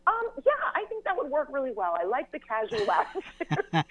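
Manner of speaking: high, careful professional tone; a young writer faking calm to a star; polite nervous reply. Retold or imitated. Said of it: "But I was just like".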